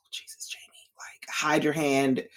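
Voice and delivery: whispered, slow hushed warning; urgent whisper. Retold or imitated. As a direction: hiss it to Jaime like he can hear you. Urging Jaime under her breath.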